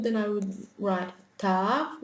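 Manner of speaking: normal talking voice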